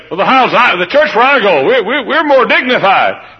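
mock dignified churchgoer. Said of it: "You say".

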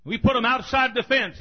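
loud, high, emphatic pulpit voice; preacher in full cry; fiery preaching. Acted. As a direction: drive it home to the congregation.

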